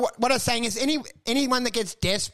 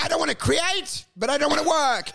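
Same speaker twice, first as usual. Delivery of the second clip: begging whine; 7 semitones higher than usual. Retold or imitated. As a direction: beg like a lazy kid who won't work. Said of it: "I'm like".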